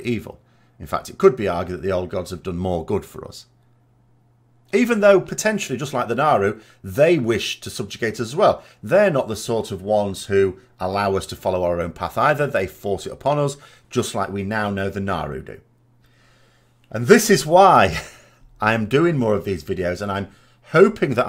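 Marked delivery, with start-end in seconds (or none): none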